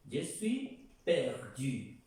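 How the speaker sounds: Je suis perdu drawn out slowly and carefully; slow model French. Shown as a demonstration. Teacher repeats Je suis perdu slowly for students. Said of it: "¿Ok?".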